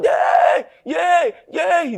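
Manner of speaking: high, loud panicked yays; hammed-up frantic shouts; shouting yay. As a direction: shout like someone frightened in the dark. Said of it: "Some will shout".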